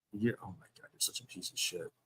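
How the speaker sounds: very soft, low disgusted mutter; quiet, sunken-voice contempt; muttered insult. Real, not acted. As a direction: say it under your breath, fed up. Insult muttered in real argument.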